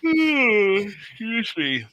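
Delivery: high, drawn-out yawn into words; real yawn, slow and high. Real, not acted. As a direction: talk through a big yawn. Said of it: "I apologize for the yawning".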